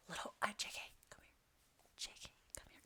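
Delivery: hushed whisper calling a friend over; secret whisper. Retold or imitated. Whispering to the trainer to come over without the team noticing, hushed. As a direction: whisper so the team won't hear.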